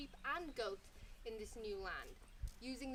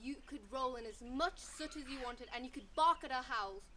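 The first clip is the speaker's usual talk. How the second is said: emphatic rejection, building louder; pack leader; 12 dB louder than usual. A narrator's reading. Leader of the wild dogs rejects sheepdog, stern. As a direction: hammer home the refusal. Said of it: "the leader of the wild dogs said".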